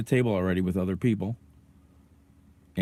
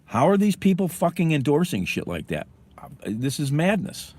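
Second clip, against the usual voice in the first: higher, incredulous outburst; outraged; 7 semitones higher than usual. Real, not acted. Ranting to viewers about rally flags, outraged and higher. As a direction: let the disbelief burst out higher.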